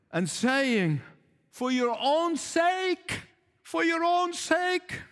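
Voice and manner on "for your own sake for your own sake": high, slow earnest plea; pleading. Acted. Jesus pleading with the crowd, high and slow. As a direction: beg them gently for their own sake. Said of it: "He's pleading with them".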